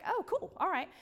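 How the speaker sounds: high, delighted surprise; pleasantly surprised. Retold or imitated. Friend agrees to the movie, surprised high reply. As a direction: caught off guard but pleased. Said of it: "I was like".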